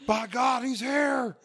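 high, shouted announcer call; hyped wrestling announcer. Retold or imitated. Friend riffs on a wrestling entrance as a screaming announcer. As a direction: scream it like a ringside commentator.

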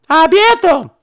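high, loud retort; escalating mock quarrel; defiant shout back. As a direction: holler it back in a childish standoff. Acted back-and-forth quarrel.

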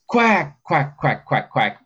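high, punchy comeback quacks; forceful quacks. Shown as a demonstration. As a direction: bark out urgent, forceful quacks. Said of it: "a comeback is more forceful".

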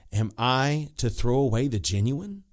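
normal talking voice

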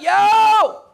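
loud, high warning shout; urgent shouted plea from God; screaming out. Acted. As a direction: yell a warning to stop him. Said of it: "calling out and screaming out".

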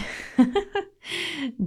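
high playful giggle. Real, not acted. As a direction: giggle at the silly word. Laughs at word Twitter-pated.

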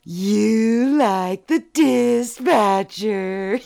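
very slow, drawn-out singsong tease; teasing. Acted. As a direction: stretch it out, gloating. Teasing triumphant accusation.

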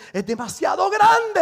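high-pitched mock dismay; alarmed doubters. Doubters size up the giant, mock-alarmed.

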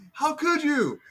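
high, loud betrayed cry; wounded disbelief. Retold or imitated. Host voicing her as a hurt kid, high and loud. Imitating hurt young daughter.

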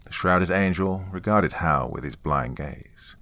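usual speaking voice

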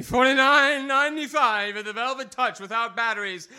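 punchline delivered loud. Acted. Punchline, price tag delivered.

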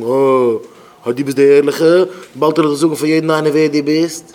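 loud sarcastic "oh, the righteous one"; mock taunt. Friend threatening to expose the pious driver, mocking.

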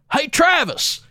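high, loud friendly call across the room, voice jumps way up; cheery shout to a coworker. Retold or imitated. Haunted-house actor drops his act to call his coworker, loud cheery shout. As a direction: suddenly drop the creepy act and hail your buddy. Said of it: "he was like".

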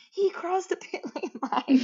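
high-pitched mock yell, little added volume; half-laughing imitation of yelling; Max yelling. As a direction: imitate an outraged complaint, breaking into a laugh. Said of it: "Max was yelling".

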